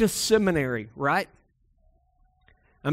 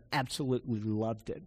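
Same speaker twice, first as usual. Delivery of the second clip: quiet sincerity; 6 semitones lower, 9 dB quieter than usual. Retold or imitated. Mentor fondly answers about seminary.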